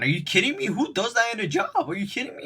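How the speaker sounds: high-pitched incredulous outburst; mock rant. Abraham Lincoln ranting about the fired worker, mock-indignant and high. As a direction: imitate an outraged boss ranting, pitch jumping way up. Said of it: "like, going on a rant".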